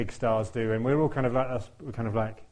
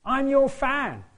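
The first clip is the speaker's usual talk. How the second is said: high excited call, YOUR stressed: "I'm your fan"; 14 semitones higher, 5 dB louder, much slower than usual. Retold or imitated. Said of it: "calls out to me and says".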